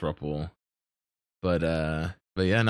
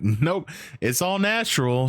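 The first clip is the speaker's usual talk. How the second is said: drawn-out, higher-pitched denial; emphatic denial; 7 semitones higher than usual. Acted. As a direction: stretch it out high and breezily deny it. Acted proud denial, drawn out.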